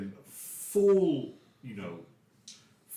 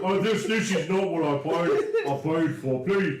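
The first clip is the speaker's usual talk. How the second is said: louder, mock-indignant half-orc customer; flustered half-orc; 3 semitones higher, 4 dB louder than usual. Half-orc client objects to intruders on his portrait sitting, acted flustered. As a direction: protest loudly as an affronted client. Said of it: "the half orc goes".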